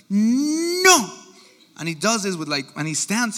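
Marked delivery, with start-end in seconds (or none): 0.1-1.1 put-on high, long drawn-out toddler no; two-year-old refusal. Preacher imitating his two-year-old standing firm, defiant refusal. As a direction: mimic a stubborn toddler, stretching it out high. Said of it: "And he's two. And he's like".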